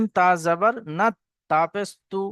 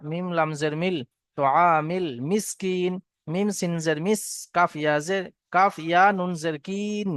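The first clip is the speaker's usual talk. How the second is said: usual speaking voice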